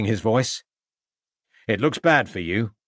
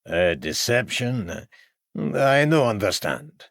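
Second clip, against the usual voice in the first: put-on slow, puzzled broken English in a foreign accent; a confused foreign violinist who cannot follow; puzzled foreigner; slower than usual.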